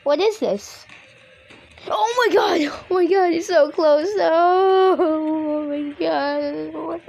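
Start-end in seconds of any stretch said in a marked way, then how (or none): 1.9-7.1 high, loud, stretched into a wail; panicked cry. Real, not acted. Player seeing it so close, panicked cry. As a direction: cry out in terror, drawing out the end.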